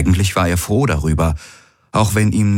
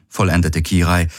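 ordinary delivery, nothing marked

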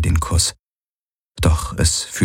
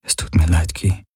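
whispery, fading apology; murmured apology. A narrator's reading. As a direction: mumble the apology under your breath. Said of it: "murmelte er". (gemurmelte, fast geflüsterte Entschuldigung)

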